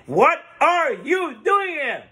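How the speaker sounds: high, slow, word-by-word shout; acted scream. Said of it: "screams to him again".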